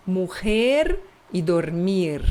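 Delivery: normal talking voice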